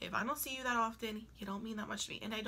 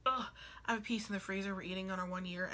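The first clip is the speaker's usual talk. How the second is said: ordinary talk, nothing marked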